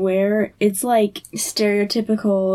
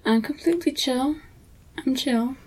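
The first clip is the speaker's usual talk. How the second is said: a softer, lightly mocking "completely chill"; mock calm; 3 semitones higher, 5 dB quieter than usual. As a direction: imitate a too-relaxed reply, a bit hushed. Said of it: "Just the way he's like".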